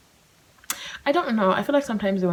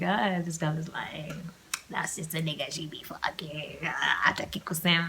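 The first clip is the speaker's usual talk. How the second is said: gossiping friends; 2 semitones lower, 6 dB quieter than usual. Retold or imitated. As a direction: mutter it like catty friends. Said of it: "They're gonna be like".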